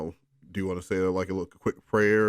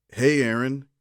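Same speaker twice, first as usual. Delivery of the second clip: slightly higher, louder greeting; lightly voiced friend; 4 semitones higher, 6 dB louder, slower than usual. Friend Derek greeting Aaron at his locker. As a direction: call out brightly as Derek. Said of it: "he said".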